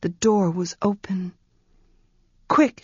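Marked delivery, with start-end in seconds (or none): 2.5-2.8 high, sharp and urgent. Acted. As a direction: hurry him through. Girl urges through iron door.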